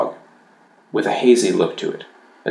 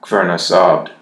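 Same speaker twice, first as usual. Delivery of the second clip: plain reading voice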